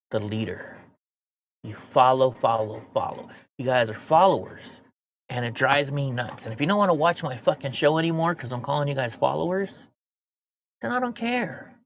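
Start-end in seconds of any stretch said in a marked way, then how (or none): none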